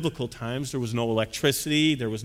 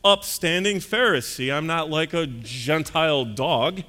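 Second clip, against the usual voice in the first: slow, drawn-out pompous drawl; smug well-to-do man sure of his invitation; presuming rich guest; 2 semitones higher, 3 dB louder, slower than usual. Acted. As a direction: stretch the words with haughty self-assurance. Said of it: "this man kind of was presuming".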